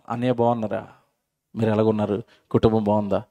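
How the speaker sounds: low, soft, friendly check-in; gentle hello to an old enemy; soft greeting. Acted. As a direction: greet him softly, making peace.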